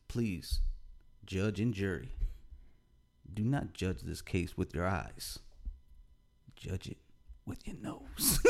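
hushed, measured courtroom plea; soft, drawn-out appeal to the jury; pleading lawyer. Acted. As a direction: play a lawyer solemnly working the jury. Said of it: "you know how lawyers like to spin it".

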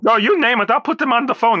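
normal talking voice

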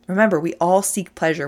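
usual speaking voice

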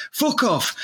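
ordinary talk

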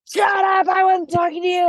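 high, far-pitched mock shriek; screaming. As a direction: imitate a furious scream, high and harsh. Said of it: "Like, screaming".

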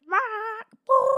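high, loud squawking dolphin sounds; loud high dolphin squeal; dolphin noises. Retold or imitated. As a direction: squawk like a dolphin trying to talk. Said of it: "and he's like".

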